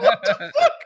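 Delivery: baffled and cracking up; laughing disbelief. Retold or imitated. Retold confusion, laughing.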